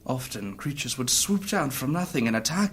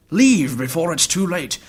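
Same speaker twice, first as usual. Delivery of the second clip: louder and higher, pressing her to go; a sharp raised plea to leave now; urgent warning; 5 semitones higher, 10 dB louder than usual. Acted. Urging the lady to flee.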